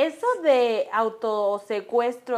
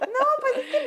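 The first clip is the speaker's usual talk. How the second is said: laughing; 12 semitones higher than usual. Real, not acted. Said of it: "both laughing".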